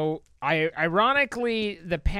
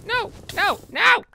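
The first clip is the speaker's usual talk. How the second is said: loud, high yelps of alarm; panicked shouting; 8 semitones higher, 6 dB louder than usual. Real, not acted. Gamer yelling at fire and balls, panicked shouting.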